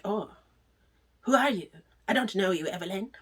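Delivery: mock French accent, broken up by pauses; badly faked French accent. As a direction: act baffled in a fake French accent. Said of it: "That's my bad French accent".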